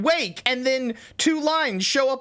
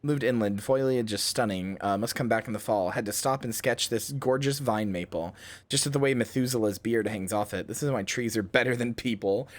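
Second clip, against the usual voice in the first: journal reading; 3 semitones lower, 4 dB quieter than usual. Acted. Reads log entries, growing uneasy.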